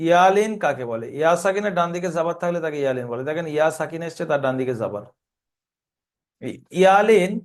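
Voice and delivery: usual speaking voice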